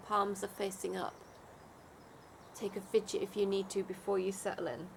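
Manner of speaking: guided meditation. Acted. Leads a Yoga Nidra meditation.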